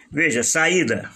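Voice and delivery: usual speaking voice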